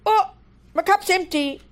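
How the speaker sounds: a teacher acting out noticing her empty cup; mock surprise. Mock surprise. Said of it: "I will go".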